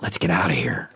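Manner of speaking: unvoiced conspiratorial whisper. A narrator's reading.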